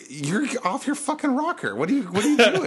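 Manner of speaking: high, exasperated disbelief; incredulous scolding. Retold or imitated. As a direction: scold him in outraged, high-pitched disbelief. Scolding drunk customer in disbelief.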